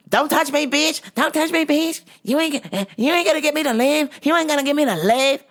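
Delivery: high-pitched, hammed-up defiant outburst; mock agitated protest. Acts out customer refusing manager.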